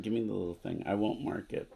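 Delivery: ordinary talk, nothing marked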